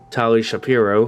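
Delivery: ordinary delivery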